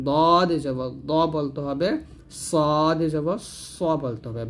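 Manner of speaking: slow, careful demo of "জবর খ" and the rest with the thick, full-mouth sound; heavy letters demo. Shown as a demonstration. Teacher to students, showing the heavy letters slowly. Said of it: "এই আটটা আপনাকে ভালো করে বলতে হবে".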